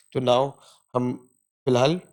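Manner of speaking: usual speaking voice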